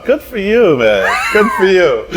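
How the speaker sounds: high, laughing mock praise; ribbing him through a laugh; teasing laugh. Real, not acted. Chef teasing partner about loving Austin, laughing mock praise.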